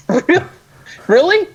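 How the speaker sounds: incredulous laugh, pitch jumping high; laughing disbelief. Real, not acted. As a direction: laugh at the absurdity, really?. Outraged disbelief at companies.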